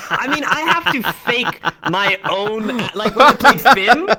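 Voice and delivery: laughing out loud; laughing confession. Real, not acted. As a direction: giggle your way through the admission. Laughing while admitting faked accent.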